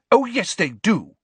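high, loud indignant retort; angry Luna. A narrator's reading. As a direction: fire it back, offended. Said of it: "said Luna angrily".

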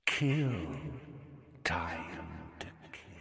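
low, soft, menacing hiss; sinister monster voice. A narrator's reading. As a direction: creep each word out cold.

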